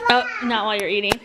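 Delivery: ordinary talk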